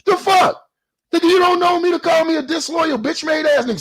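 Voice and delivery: indignant outburst. Real, not acted. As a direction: explode at the accusation. Heated callout of a rival.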